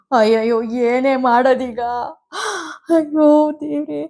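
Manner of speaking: ಅಳುತ್ತಾ ಗೋಳಾಡುವ ದನಿ; wailing. A narrator's reading. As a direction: cry out to God in despair. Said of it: "ಅಳುತ್ತಲೇ ಕೇಳಿದಾಗ".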